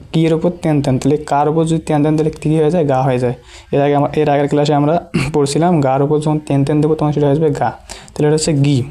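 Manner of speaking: ordinary delivery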